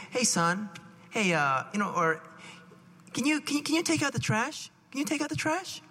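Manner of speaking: gently coaxing parent, lifting in pitch; nice, gentle, loving. Acted. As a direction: ask your child sweetly, no pressure. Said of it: "you say it very nicely, very gently".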